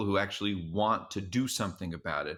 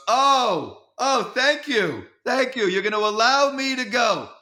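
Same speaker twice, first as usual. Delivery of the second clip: loud, high sarcastic mock gratitude; Larry; 12 semitones higher, 6 dB louder than usual. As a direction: gush thanks in a hammed-up, high impression. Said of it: "And he goes".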